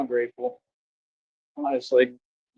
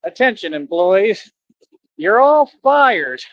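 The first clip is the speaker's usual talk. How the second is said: rising to a loud high slow "you're all fired"; cat boss announcement; 4 semitones higher, 4 dB louder, slower than usual. Retold or imitated. Villain cat firing employees over intercom, loud and slow. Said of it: "and he's like".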